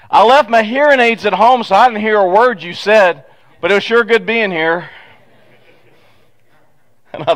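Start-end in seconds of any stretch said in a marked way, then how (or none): 0.1-4.9 louder, higher voice of a half-deaf man; loud old man. Retold or imitated. Parishioner at the door speaks to the pastor, loud and high. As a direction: play a man who can't hear himself, calling out cheerfully. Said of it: "Jack walks up and he goes".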